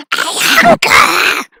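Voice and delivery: very slow, loud creature moan; creepy hungry monster. Acted. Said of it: "creepy voice".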